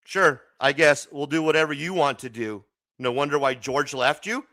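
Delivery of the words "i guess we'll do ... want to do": ordinary delivery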